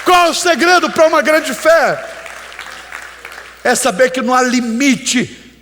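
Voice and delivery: voz alta e aguda, martelando as palavras; pregação inflamada. Real, not acted. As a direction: pregue num crescendo a plenos pulmões. (pregação exaltada, em brado)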